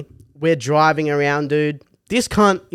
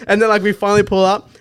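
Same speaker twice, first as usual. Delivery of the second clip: normal talking voice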